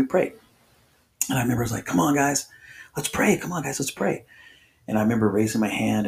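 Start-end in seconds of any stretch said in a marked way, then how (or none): 1.9-4.2 mildly urging, raised pitch; gently rally the group in a higher voice; coaxing. Retold or imitated. Urging friends to join prayer.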